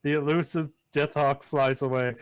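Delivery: ordinary talk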